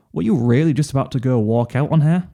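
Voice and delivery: plain reading voice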